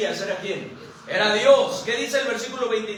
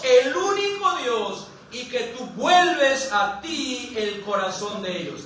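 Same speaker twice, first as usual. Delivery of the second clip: very slow, raised preaching cry; impassioned plea; 3 semitones higher, slower than usual. Acted. Elías pleads for God's answer, slow impassioned cry. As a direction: plead to heaven, drawing out each word.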